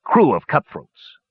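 halting, sheepish admission; embarrassed. A narrator's reading. Dwarf captain introducing his gnome crew, embarrassed and hesitant. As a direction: search awkwardly for the word.

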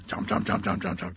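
rapid-fire mouthy chomps; munch through each word; imitated chomping. Gator keeps eating at the tree, chomping noises.

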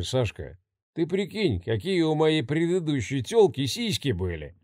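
put-on soft, slurred drunken bragging; drunk slurring. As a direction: brag with a drunk, loose tongue. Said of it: "Заплетающимся языком рассказывал он".

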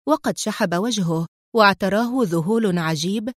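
ordinary delivery, nothing marked

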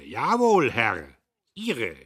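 laut und hoch, wütend herausgedonnert; donnernd. A narrator's reading. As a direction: wie ein Offizier auf dem Kasernenhof donnern. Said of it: "Der Major donnerte". (der Major donnert Diederich zornig an)

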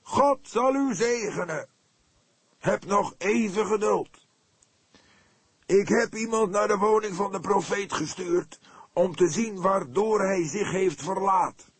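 very high, loud, slow and drawn out; unctuous preacher. A narrator's reading. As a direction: anoint the crowd with honeyed words. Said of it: "begon hij zalvend". (zalvend als een predikant, hoog en traag)

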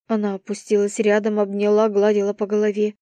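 ordinary delivery

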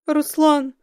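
high tearful cry of a name. A narrator's reading. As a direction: call out through tears. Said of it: "плакала она в трубку".

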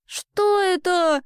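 high, slow astonishment; stunned girl. A narrator's reading. Girl asking the weaver about the noise, stunned. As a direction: ask it high and drawn out, dumbfounded. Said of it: "ошарашенно спросила Элли".

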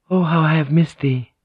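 a touch of aching tenderness; loving woman. Acted. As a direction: greet a secret lover with longing. Lovers embracing alone, woman's line.

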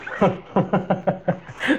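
a quick relieved chuckle; brief amused laugh in the dark forest; short laugh. Acted. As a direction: laugh off the fright. Bill laughs off the scare.

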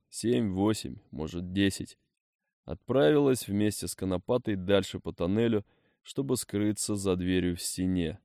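ordinary delivery, nothing marked

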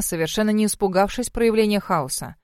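usual speaking voice

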